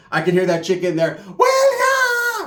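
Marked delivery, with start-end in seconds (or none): 1.4-2.5 a woman's loud squeal overheard through the phone; high shouted squeal. Retold or imitated. Chick heard through phone.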